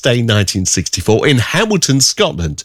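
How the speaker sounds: upbeat radio DJ read; radio announcer. Acted.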